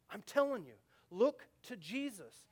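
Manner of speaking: ordinary delivery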